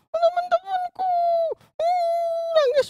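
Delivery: put-on very high, loud puppet girl whimpering; a little girl starting to cry; Susan puppet crying. Puppet girl Susan whimpers to the pastor about her friends in a very high voice. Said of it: "ini namanya Susan".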